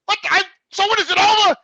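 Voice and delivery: a man yelling at the lawyer's caller, building up; retold angry rant. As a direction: tell her off, getting hotter.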